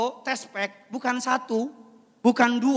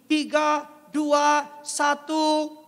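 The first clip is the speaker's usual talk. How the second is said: high, loud countdown call; 6 semitones higher, 6 dB louder, much slower than usual. Pretend. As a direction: count down to midnight with the crowd. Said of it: "leading countdown". (hitung mundur keras)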